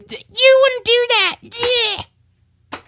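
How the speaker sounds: high falsetto whimper, slow; whining puppet. Acted. Hand puppet whimpering to dad not to tell mother. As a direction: beg in a crying puppet voice.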